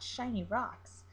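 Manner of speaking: usual speaking voice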